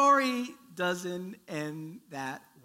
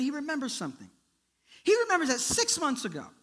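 ordinary delivery

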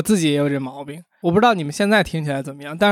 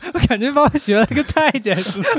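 laughing; 9 semitones higher than usual. Real, not acted. Laughing at being imitated.

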